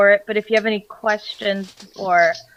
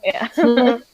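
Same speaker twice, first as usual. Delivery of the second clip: usual speaking voice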